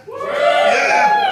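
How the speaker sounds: stand-up comic shouts a cheer back at the audience; mock crowd cheer. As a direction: call out like a fan in the crowd. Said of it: "Anybody been to Edinburgh here?".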